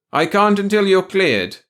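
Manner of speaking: high, loud, slow refusal; stern refusal. A narrator's reading. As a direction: refuse officiously, drawn out. Officer refuses loudly.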